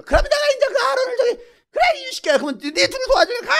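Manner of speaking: high-pitched comic rant; exasperated God. Acted. God hands the job to Aaron, exasperated. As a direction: splutter out the fallback plan.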